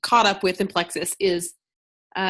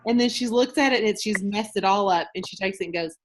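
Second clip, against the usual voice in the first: normal talking voice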